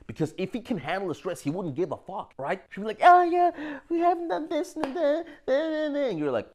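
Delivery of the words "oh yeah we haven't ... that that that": high, put-on whiny girl; mock girlfriend voice. Mocking a girlfriend listing complaints, high and whiny. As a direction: whine it like a nagging girlfriend.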